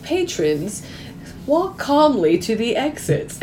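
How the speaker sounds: slow, drawn-out intercom announcement; museum intercom. Retold or imitated. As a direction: imitate a calm PA voice, stretching each word. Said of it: "the museum intercom was like".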